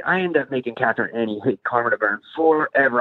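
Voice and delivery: ordinary talk, nothing marked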